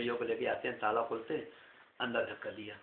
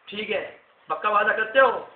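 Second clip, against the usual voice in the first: loud, high stern demand; angry demand; 8 semitones higher, 11 dB louder than usual. Acted. Angry Potne demanding the king's promise, stern. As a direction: press him hard for a promise. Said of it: "गुस्सा आ गया पौधे को".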